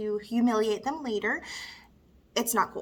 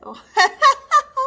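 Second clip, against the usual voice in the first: loud, high burst of laughter; high cackling laugh; awkward laugh; 14 semitones higher, 10 dB louder than usual. Acted. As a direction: laugh off a bitter remark. Laughs after bitter pay remark.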